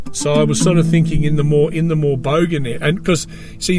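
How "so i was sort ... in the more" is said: normal talking voice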